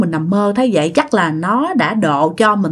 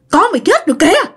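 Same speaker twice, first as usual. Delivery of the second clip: high, fast, eerie urgent warning; dead aunt warning; 11 semitones higher, faster than usual. Retold or imitated. Dì Bảy warns Út her baby is dead, high and hurried. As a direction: rush it out like a ghostly alarm. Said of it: "mẹ lại nghe tiếng dì Bảy nữa".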